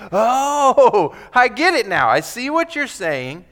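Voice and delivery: light up as it clicks; sudden realization. Retold or imitated. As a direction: disciples suddenly understanding. Voicing disciples' realization.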